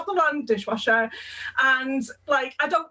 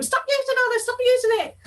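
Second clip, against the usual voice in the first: mock freak-out; 9 semitones higher than usual. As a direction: throw up your hands at the slippery bath. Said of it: "freaks out all the time".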